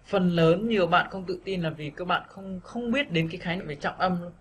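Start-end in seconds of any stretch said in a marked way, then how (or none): none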